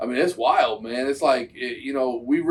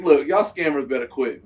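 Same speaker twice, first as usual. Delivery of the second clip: ordinary talk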